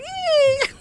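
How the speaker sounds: tiny high squeal; high eek. Retold or imitated. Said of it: "It's like a very high eek".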